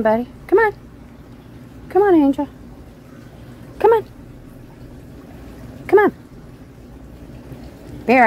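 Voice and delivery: high-pitched coaxing pet call. Real, not acted. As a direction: call the cats in, voice lifted way up. Calling the cats in from outside.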